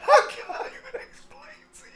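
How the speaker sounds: high, tearful laughing; barely able to speak for laughing; crying with laughter; laughing through words. Real, not acted.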